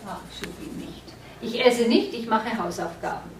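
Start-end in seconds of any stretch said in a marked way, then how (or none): 0.1-1.0 a quick whispered aside to fix the slip; whispered self-correction. Real, not acted. Teacher catching her own error, whispered to herself. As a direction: whisper "ah, should be nicht" under your breath.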